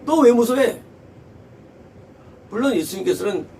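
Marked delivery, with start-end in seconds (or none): 0.1-0.8 louder, higher mock scolding; acted rebuke. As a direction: scold them sharply, as in a retold rebuke. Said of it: "예수님께서는 곧바로 책망인 거".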